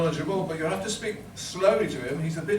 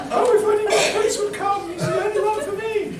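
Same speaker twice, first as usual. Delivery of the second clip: high falsetto panto princess; Cinderella voice; 4 dB louder than usual. Acted. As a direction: swoon it in a squeaky falsetto. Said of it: "maybe a tadlet too piratical still".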